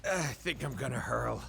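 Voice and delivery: low, soft queasy groan; about to throw up; nauseous. Acted. Nauseous after crash.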